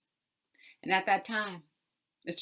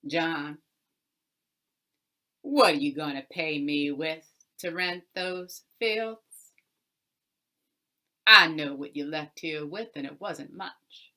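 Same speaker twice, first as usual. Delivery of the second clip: a louder, hard-bargaining Mister Jones; gruff landowner voice; 9 dB louder than usual. Acted. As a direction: lean over him, driving a bargain, louder.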